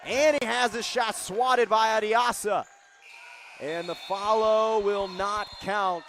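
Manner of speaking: loud, high call of a big block; real thrilled commentator yell; excited play-by-play shout. Real, not acted. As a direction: shout it out, stretching the last words. Live call of a blocked shot.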